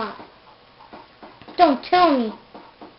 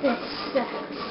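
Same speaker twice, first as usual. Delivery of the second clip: whispered; 3 semitones lower, 8 dB quieter than usual. Real, not acted. Frustrated aside.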